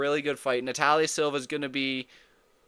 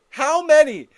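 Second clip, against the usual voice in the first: heated yell; 12 semitones higher, 11 dB louder than usual. Real, not acted. Heated rant about knockdowns.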